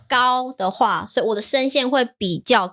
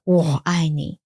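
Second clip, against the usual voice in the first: 我爱你 said much lower, slow and heavy; deep gruff Geralt-style 我爱你; Witcher voice; 6 semitones lower, slower than usual. Pretend. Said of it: "你是Witcher吗".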